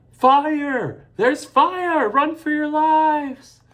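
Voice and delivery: high, loud, slow yell; shouting a warning. A narrator's reading. A stranger yells fire to the whole building. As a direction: bellow the alarm down the street.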